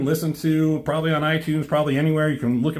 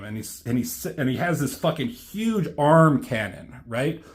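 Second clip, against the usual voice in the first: normal talking voice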